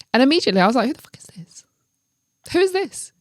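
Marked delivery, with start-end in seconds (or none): 0.9-1.6 hushed, whispered disbelief; whispered reaction. Retold or imitated. Reacting to a friend's odd message, whispered suspicion. 2.5-3.1 high-pitched, voiced incredulous question; suspicious demand. Questioning whoever sent the odd message, high incredulous demand. Repeats the suspicious reaction out loud.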